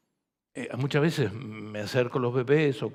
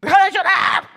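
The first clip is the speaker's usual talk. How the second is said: much higher, much louder scolding yell; mock mother yelling; 15 dB louder than usual. Pastor mimics a mother shouting at her baby, a hammed-up yell. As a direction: bark it like an exasperated mother at a crying baby. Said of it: "Ninguna madre está diciendo".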